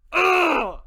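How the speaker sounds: loud, high "ugh" of disgust; frustrated groan. Real, not acted. Furious at the villains.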